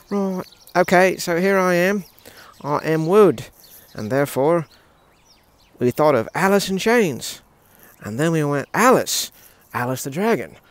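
loud, declaiming mock-British voice; British accent. Telling the dragon's naming tale, in loud put-on British. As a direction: project it in a playful British accent. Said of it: "apparently you're British, dude, so let's just go with it".

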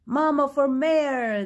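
high, loud, slow chant; crowd shout. A narrator's reading. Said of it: "they shouted".

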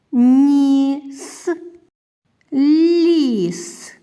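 short words stretched sound into sound; slow beginning-reader demonstration, words set apart. Shown as a demonstration. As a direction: hold the middle of each word, like Лак, мак, сік, long and steady. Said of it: "прочитаємо коротенькі слова".

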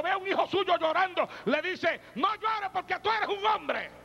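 high, impassioned preaching to the congregation; full-force preaching. Acted. Preacher urging fathers to hug their children, full force. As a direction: plead with the fathers from the pulpit.

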